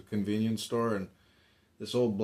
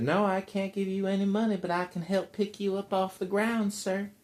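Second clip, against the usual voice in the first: higher-pitched put-on voice; woman helper; 10 semitones higher than usual. Storyteller voices the woman answering a beggar, imitating. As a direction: imitate a woman politely refusing.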